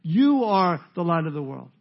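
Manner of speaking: "You" long and punched loud; stress on YOU. Shown as a demonstration. Preacher quoting Jesus on the light, strong stress on "You". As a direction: hit "You" hard and hold it. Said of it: "It's the emphasis on the word you".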